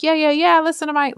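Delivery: high, loud egging-on; mock yelling. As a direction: imitate someone yelling along in support. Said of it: "comes up and behind them yelling".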